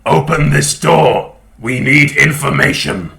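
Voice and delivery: loud, gruff demand through a door. Acted. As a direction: bellow it like a gruff caller at the door. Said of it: "You hear a gruff voice going".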